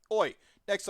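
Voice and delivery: a lightly done Cockney call; playful Cockney greeting. Retold or imitated. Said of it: "I don't know why I went Cockney there".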